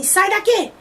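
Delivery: imitated furious outburst; retold angry order. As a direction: act out throwing someone out. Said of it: "xinga ele, fala assim".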